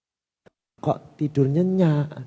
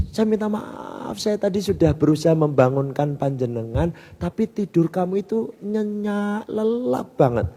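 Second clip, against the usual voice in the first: apologetic friend; 6 semitones higher, 4 dB quieter than usual. Retold or imitated.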